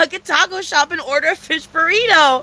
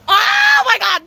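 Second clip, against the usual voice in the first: excited exclaim; 9 semitones higher, 4 dB louder, slower than usual. Real, not acted. Sudden exclaim.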